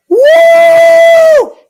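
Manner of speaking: high, loud cheer of delight; excited whoop. Real, not acted. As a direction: whoop with real thrill. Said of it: "Did that sound like sarcasm?".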